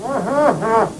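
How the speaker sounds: wordless muffled reply; mouth full. Acted. Trapped friend tries to answer, mumbling through a full mouth. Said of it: "Don't talk with your mouth full".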